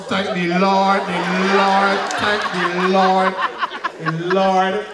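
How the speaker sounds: lightly imitated pious refrain, slow and drawn out; religious grandmother. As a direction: stretch "Lord" out long, mimicking a devout granny. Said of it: "Every second word".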